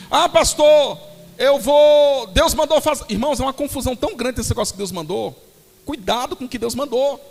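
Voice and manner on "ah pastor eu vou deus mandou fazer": raised, loud mock announcement; insistent church member, imitated. Church member telling the pastor of a decision, mock insistent. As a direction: imitate a member pleading their case, higher and louder.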